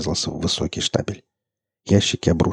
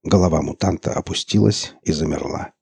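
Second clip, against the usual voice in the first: ordinary delivery, nothing marked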